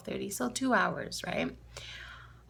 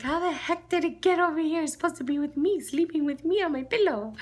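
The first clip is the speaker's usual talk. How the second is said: high, louder imitation of an indignant child; Lila; 8 semitones higher, 4 dB louder than usual. Niece missing her toy from the pillow, childishly indignant. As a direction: whine like a small girl wanting her toy. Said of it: "and she's like".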